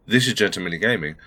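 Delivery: ordinary delivery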